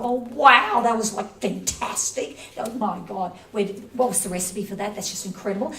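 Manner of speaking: loud, gushing "Oh, wow" then raving fast; gushing excitement. Pretend. Mock foodie raving over cheesecake, loud and gushing. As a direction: ham up the foodie thrill. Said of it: "They gotta go".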